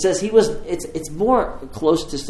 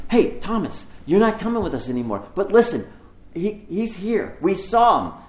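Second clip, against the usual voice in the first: higher, eager pleading to a friend; excited urging; 8 semitones higher than usual. Acted. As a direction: rush it with excitement, voice up high. Disciples urging Thomas to return.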